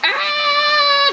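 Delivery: high, held scream into a shout; raging gamer battle cry; very loud yell. Real, not acted. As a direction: stretch the cry, then hurl the word. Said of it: "I am very loud".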